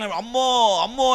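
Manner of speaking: high-pitched mock cries at normal volume; imitated screaming. Passengers crying out just after the crash, imitated screaming. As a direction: act out frightened passengers crying out, without full force. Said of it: "అని అరుస్తున్నారు".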